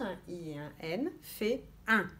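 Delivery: ordinary talk, nothing marked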